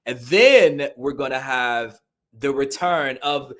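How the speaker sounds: slow hype announce. Real, not acted. Hyping Gunther's return to the co-host, slow and loud. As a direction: Ring General announced loud and high, slow.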